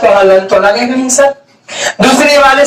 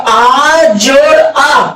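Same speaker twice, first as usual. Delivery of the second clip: slow dictation; much slower than usual. Shown as a demonstration. Teacher slowly spelling out the vowel sum to students. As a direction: stretch out आ जोड़ आ very slowly. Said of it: "देखिए यहां पे हमने बताया ना".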